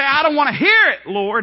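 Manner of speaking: high, loud outburst of frustration; angry refusal. Acted. Believer yelling back at God in the storm, high and loud. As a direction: shout it back at heaven.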